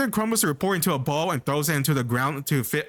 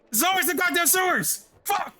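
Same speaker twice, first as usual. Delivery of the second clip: high-pitched exasperated shriek; frustrated yell. Real, not acted. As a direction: explode in disbelief at the sewers again. Frustrated outburst at game.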